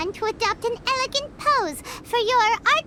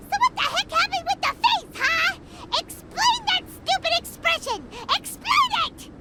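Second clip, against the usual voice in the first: higher, indignant demand; angry outburst; 6 semitones higher than usual. Acted. Paimon yelling at the painter over her portrait, indignant. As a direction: scold the painter for the botched face. Outraged at ugly portrait face.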